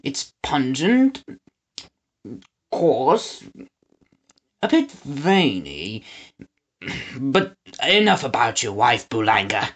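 slow, savoring cheese critic; slow tasting voice. Acted. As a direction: roll each word like a connoisseur. Father character voice.

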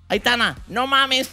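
high, slow Mexican-style "no mames"; mock Mexican slang. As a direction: drawl "Aitana, no mames" in a put-on Mexican accent. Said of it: "primer consejo de padre".

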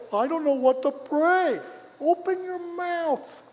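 high pleading voice. Shown as a demonstration. Mimicking a hesitant prayer, then urging.

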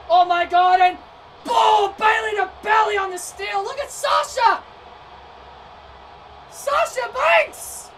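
excited outcry. Real, not acted. Commentator erupts at big spot.

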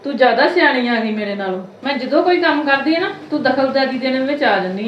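loud, sarcastic telling-off; angry scolding. Acted. Mother-in-law berating her daughter-in-law, loud sarcastic anger. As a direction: snap back at her, loud and bitter.

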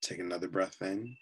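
calm, unhurried voice with long pauses between phrases; guided meditation. Real, not acted. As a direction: guide them gently into relaxation, often softening at phrase ends.

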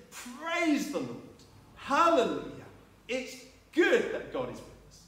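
high, rising loud hallelujah; joyful praise. Retold or imitated. Zechariah finally speaks, loud joyful praise. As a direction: burst out in praise. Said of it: "He said".

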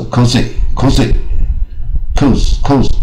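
"cozy" and "coats" as slow wrong takes with long O; botched pronunciations. Shown as a demonstration. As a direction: reel off mistaken "cozy", then "coats", slowly. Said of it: "就念得乱七八糟".

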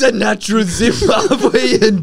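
high, loud, drawn-out mock Zimbabwean accent; hammed-up Zimbabwean accent with a laugh. Co-host goading the other to do the accent, mock Zimbabwean accent. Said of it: "I'm not doing the accent".

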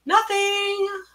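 high, drawn-out innocent answer; sweetly high-pitched denial. A narrator's reading. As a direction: sing it out high, covering up. Honey denies it to boss.

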